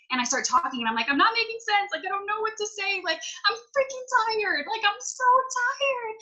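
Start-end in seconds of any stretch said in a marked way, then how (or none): 1.1-6.2 high-pitched mock whine, far above normal talk; hammed-up overtired meltdown; exhausted whining. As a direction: replay a worn-out rant in a squeaky high voice, dragging out the last words. Retelling her own exhausted rant.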